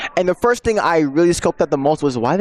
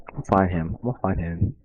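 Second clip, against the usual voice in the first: menacing low mutter; 6 semitones lower, 8 dB quieter, faster than usual. Pretend. Menacing repeated threat.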